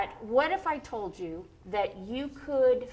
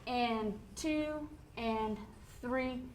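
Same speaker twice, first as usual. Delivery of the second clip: slow counting; 7 dB quieter, much slower than usual. Shown as a demonstration. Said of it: "we're gonna count those".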